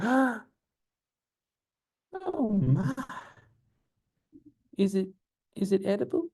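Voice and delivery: high, scandalized Southern lady; halting high Southern voice, shocked then curious. Retold or imitated. Lady learning the mushroom's name, shocked Southern accent. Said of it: "Love doing the Southern talk".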